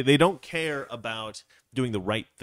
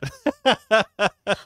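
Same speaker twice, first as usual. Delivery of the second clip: high, loud burst of laughter; amused high-pitched cackle; real laugh; 12 semitones higher, 7 dB louder than usual. Real, not acted. Amused after announcing Valeria's reaction.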